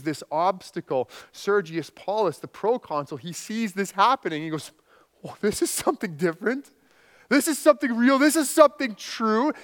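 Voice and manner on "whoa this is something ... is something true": amazed. Retold or imitated. Proconsul amazed by miracle.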